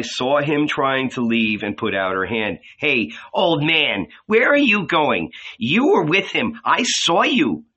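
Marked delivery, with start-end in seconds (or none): 2.8-7.6 higher, louder accusing call; maidservant. A narrator's reading. Second maidservant stopping Peter, higher and louder accusation. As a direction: catch him out as he leaves. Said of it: "Another maidservant passed by".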